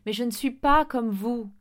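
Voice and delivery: loud, emphatic protest; insistent. A narrator's reading. Boy refusing to be like the teachers, insistent. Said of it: "insista David".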